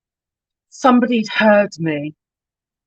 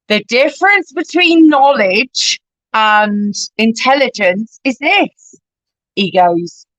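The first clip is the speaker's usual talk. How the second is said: slow, raised West Country drawl; West Country deacon; 5 semitones higher, 4 dB louder than usual. Retold or imitated. Deacon lecturing in a West Country accent. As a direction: preach the tomato joke in a West Country accent. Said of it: "proper West Country guy like me".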